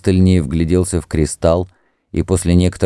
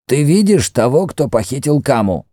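high-pitched questioning priest; Mefres the priest; 8 semitones higher than usual. Acted. High, pressing priest's voice. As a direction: priest questions the entranced Greek about the kidnapper, high and insistent.

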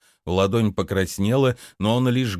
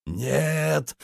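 pained cry; 6 semitones higher than usual. A narrator's reading. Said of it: "закричал он, захлёбываясь болью".

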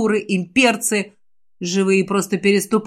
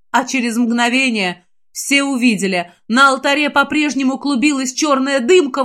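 usual speaking voice

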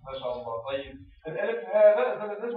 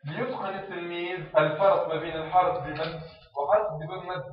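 ordinary talk, nothing marked

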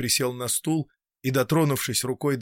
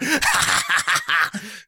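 hoarse laugh; 6 semitones higher than usual. A narrator's reading. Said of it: "хрипло рассмеялся".

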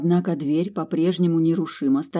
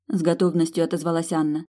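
ordinary delivery, nothing marked

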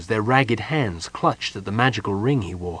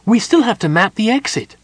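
high, loud, urgent gnome protest; alarmed cry; 11 semitones higher, 6 dB louder than usual. A narrator's reading. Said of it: "the gnome cried".

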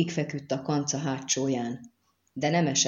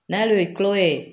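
high, loud call; shouted warning; 4 semitones higher, 5 dB louder, slower than usual. A narrator's reading. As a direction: call out urgently to stop the archer. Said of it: "a nagy ordítozásban".

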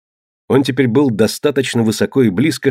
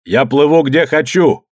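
defiant shout; 4 semitones higher than usual. A narrator's reading. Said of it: "крикнул в ответ Иодан".

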